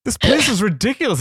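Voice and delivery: mock an entitled diner griping; whiny customer. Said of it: "people are like".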